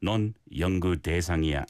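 Korean phrase in an even, connected pattern; flowing rhythm. Shown as a demonstration. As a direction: keep the low-pitched phrase flowing. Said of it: "the rhythm and the pattern of the phrase".

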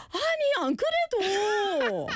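high laughing Busan dialect line. Retold or imitated. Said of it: "That's 사투리 for sure".

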